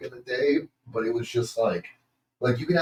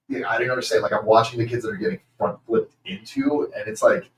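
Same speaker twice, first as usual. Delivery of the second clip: ordinary delivery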